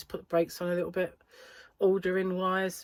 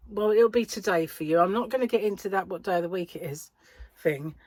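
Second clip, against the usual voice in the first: ordinary delivery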